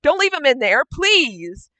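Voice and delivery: acted cry, pleading for someone trapped; screamed plea. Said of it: "and she's screaming".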